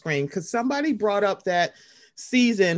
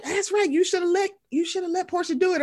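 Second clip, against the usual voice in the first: higher mock cheering voice; mocking fans; 9 semitones higher than usual. Mocking fans cheering on Portia, higher mock voice. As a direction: imitate gleeful fans, pitched up. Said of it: "people were like".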